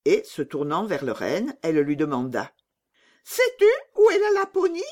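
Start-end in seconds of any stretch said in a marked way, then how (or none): 3.2-4.8 very high, loud little bandit; demanding robber girl. Acted. Robber girl questioning her reindeer, very high and brusque. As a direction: squeak the question out commandingly.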